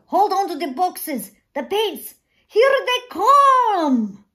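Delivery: put-on old man shouting as horses thunder by; urgent shout. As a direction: yell over the hoofbeats. Calling out as cavalry nears.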